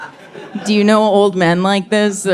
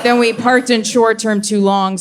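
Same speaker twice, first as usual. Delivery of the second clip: ordinary talk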